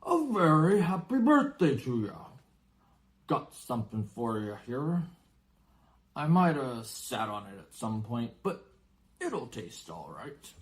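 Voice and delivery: warm rough friendliness of a giant; friendly giant. Acted. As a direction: giant wishing Harry happy birthday, hearty. Giant gives Harry birthday cake.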